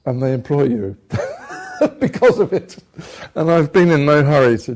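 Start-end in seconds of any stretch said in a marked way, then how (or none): 1.0-1.9 a sudden, very high laugh; high squeal of laughter. Real, not acted. Laughs at own joke.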